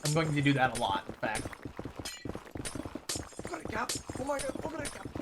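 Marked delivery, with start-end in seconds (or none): 3.4-4.0 imitating a hyped caster shout, higher; a hyped commentator's call; mock esports caster yell; mock hype call. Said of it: "That's not the way to say Ogre Kappa". 4.2-5.1 much higher, hammed-up caster cry; squealing imitation of a hyped commentator; mock hype call. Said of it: "That's not the way to say Ogre Kappa".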